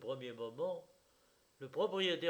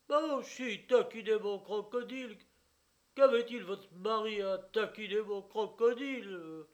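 high, loud angry bawl; bawling reproach; 6 semitones higher, 6 dB louder than usual. Acted. Owner bawling at the wife for teasing his crocodile, loud angry. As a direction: yell the blame at the wife. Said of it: "braillait celui-ci".

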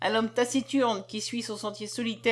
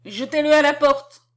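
raised, loud angry command; higher, louder, imperious fury; wrathful order; 4 semitones higher, 8 dB louder than usual. A narrator's reading. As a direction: order it out in anger. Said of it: "la voix courroucée de la commandante s'élève".

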